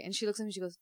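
ordinary talk, nothing marked

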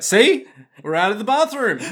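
high, loud "see?"; mock triumph. Showing friends the escape worked, high mock triumph. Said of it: "He's like".

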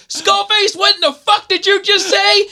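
very high, accented mock outrage; yelling drill instructor. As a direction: challenge the recruit in a high imitation bark. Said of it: "And he was like".

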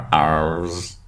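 low, quiet pirate growl on "R's"; pirate accent. Retold or imitated. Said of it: "It's my pirate impression".

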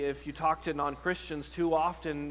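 normal talking voice

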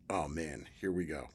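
low, soft retold resignation; resigned. Actor who must now honor the bet, resigned. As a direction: sink into it, quiet and low.

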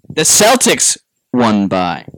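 slow, deliberate, for an audio clip; slow announcer read. Shown as a demonstration. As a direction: read it slowly like a recorded line. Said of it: "All right, guys, you ready?".

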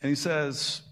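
normal talking voice

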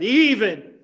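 EVEN punched out loud, pitch swooping down; stress on EVEN. Real, not acted. Preacher listing what Daniel faced, stress on EVEN. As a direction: hammer the word "even". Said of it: "I like the emphasis on the word even".

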